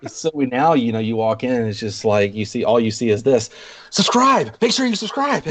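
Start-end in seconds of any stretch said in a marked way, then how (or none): 3.9-5.4 high-pitched mock vlogger plug; mimicked streamer. Host imitating park vloggers begging for subscribers, high mock shout. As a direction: call it out like an eager streamer, high.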